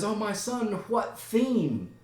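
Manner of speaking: high-pitched motherly plea; Gertrude. Acted. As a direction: plead with your son, voice high. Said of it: "And Gertrude says".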